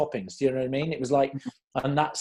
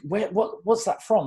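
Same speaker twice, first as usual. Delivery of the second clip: high-pitched, retold astonished question; surprised reaction; 9 semitones higher than usual. Audition panel to actor after an unfamiliar monologue, surprised. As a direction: imitate a taken-aback listener, pitch jumping up.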